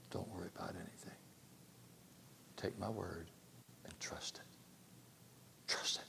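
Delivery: soft whisper, slow on trust; whispered reassurance. Acted. God whispering to the believer to trust, hushed. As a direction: breathe the words like a secret promise.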